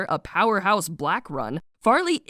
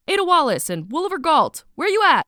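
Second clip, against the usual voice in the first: raised, loud playful holler; calling out; 6 semitones higher, 5 dB louder than usual. Pretend. Mare hunting names on Julian's list, playful call-out. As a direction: call for missing people, pitch climbing.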